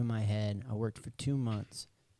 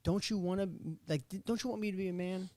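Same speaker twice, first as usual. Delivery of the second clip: higher, whiny pleading, bargaining with God; 6 semitones higher than usual. Retold or imitated. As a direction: beg like a kid asking for permission. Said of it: "And I'm like".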